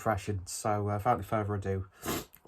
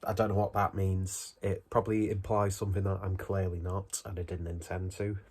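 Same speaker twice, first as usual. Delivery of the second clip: ordinary talk, nothing marked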